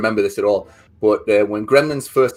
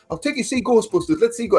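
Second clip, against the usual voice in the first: lightly voiced coaxing dad, higher pitch; consoling dad; 10 semitones higher than usual. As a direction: imitate a dad trying to console his child. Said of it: "my dad trying to console me".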